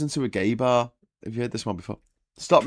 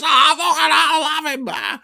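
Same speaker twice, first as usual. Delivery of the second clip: very high, loud frenzied yell; hammed-up fighting fury; raging Scouser; 9 dB louder than usual. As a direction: scream threats while being held back. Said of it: "the Scouser's still like this".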